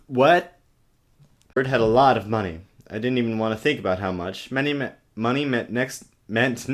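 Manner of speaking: ordinary talk, nothing marked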